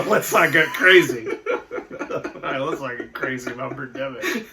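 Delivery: laughing through the words; can hardly get it out for laughing; laughing at a friend's joke. Real, not acted. Laughing at Scorsese comparison.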